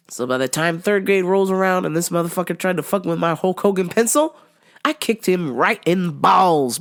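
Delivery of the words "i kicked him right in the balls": normal talking voice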